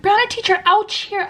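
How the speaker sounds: put-on high little-kid complaint; put-on high child voice; imitating hurt student. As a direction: mimic a small pupil reporting a hurt. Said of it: "if they got hurt, they'd be like".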